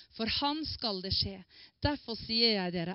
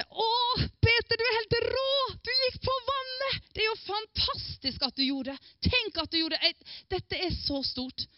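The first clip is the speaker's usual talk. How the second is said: much higher, gushing excitement; overjoyed praise; 11 semitones higher than usual. Pretend. Pretend Jesus praising Peter for walking on water: gushing. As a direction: cheer him on like a thrilled fan. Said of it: "Jesus svarte".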